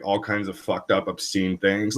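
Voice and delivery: ordinary talk, nothing marked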